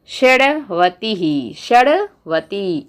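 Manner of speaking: "षडवतिः" drawn out very slowly, syllable by syllable, "षड" plus "वतिः" with no "न"; careful pronunciation. Shown as a demonstration. Teacher drills students on the tricky Sanskrit ninety-six, slow careful pronunciation. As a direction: spell out "षडवती" slowly for the students. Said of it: "अलग तरीके से".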